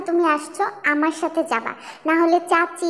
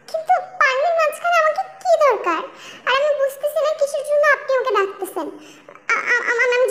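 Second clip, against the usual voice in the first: giggling; 8 semitones higher than usual. Acted. Girl giggling at being called.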